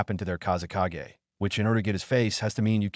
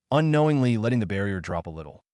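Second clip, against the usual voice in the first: ordinary delivery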